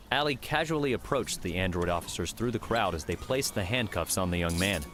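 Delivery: ordinary delivery, nothing marked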